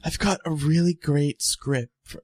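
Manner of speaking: badly faked bear impression; Pooh Bear. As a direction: put on an amateurish storybook bear voice. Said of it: "try to put on my Pooh Bear, Pooh Bear voice".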